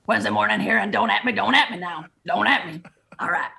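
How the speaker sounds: guttural Cajun coach pep talk; thick, guttural Cajun accent; Ed Ogeron accent. Shown as a demonstration. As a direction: rumble out a Cajun coach's locker-room speech. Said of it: "can you do the Ed accent now".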